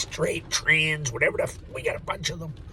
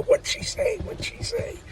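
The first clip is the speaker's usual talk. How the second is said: put-on eager kids; slower than usual. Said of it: "they go".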